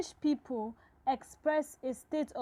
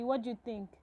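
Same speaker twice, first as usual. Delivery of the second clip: usual speaking voice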